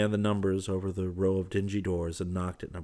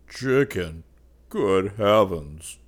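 loud, drawn-out moan; moaning dismay; 10 dB louder, much slower than usual. A narrator's reading. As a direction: wail it in slow, comic grief. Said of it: "moaned Fallowby".

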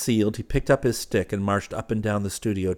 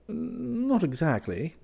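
high, slow, drawn-out teasing denial; grinning; 14 semitones higher, much slower than usual. A narrator's reading. Clifford teasing indignant Elliot, grinning. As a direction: stretch it out teasingly through a grin. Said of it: "grinned Clifford".

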